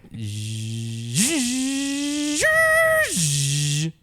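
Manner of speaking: mock engine noise rising into a roar; imitated engine downshift. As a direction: mouth a car dropping a gear. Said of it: "I gotta do the downshift as well".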